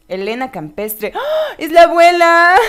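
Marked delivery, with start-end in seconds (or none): 1.1-2.6 loud, high gasp of surprise; sorpresa exclamada. Real, not acted. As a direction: exclaim it loudly at a surprise reveal. Surprise reveal in the game. (sorpresa a gritos, aguda)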